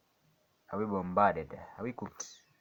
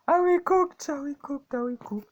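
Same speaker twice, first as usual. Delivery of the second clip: squealed high, louder at first; high mock panic; 10 dB louder than usual. Real, not acted. Trader squeals at the price chart in high mock panic. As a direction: shriek it high like a cartoon panic.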